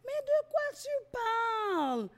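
mock high voice. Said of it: "elle me disait".

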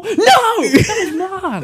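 high, slow comic protest; guard the apple with drawn-out outrage; mock possessiveness.

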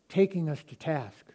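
ordinary delivery

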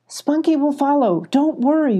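high, urgent call. A narrator's reading. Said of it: "Molly called".

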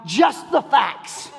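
slow, clipped-flat deadpan cop voice; Joe Friday. Retold or imitated. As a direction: drag it out deadpan like a TV detective. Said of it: "Joe Friday from Dragnet".

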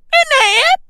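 very high, loud yelp; mock-British shouting. Comic repeating his British punchline, high shouting. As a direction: yell it way up with a questioning rise. Catchphrase peaks in a yell.

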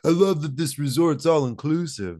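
a hint of a jolly dad on holiday. Acts out a dad at resort.

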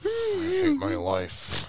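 high, slow squeaky sob; strangled, high fake weeping; mock crying. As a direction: whimper it out very slowly, hammed-up. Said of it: "I'll be laying in my bed crying".

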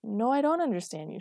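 loud, defiant retort; shouting back. A narrator's reading. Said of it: "she shouted back".

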